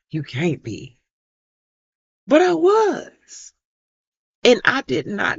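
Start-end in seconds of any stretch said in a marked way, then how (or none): 2.3-3.5 high, loud, slow "But I was"; emphatic. Real, not acted. Mother answering doctors who doubted her, emphatic. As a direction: land it hard and slow.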